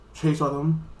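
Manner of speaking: normal talking voice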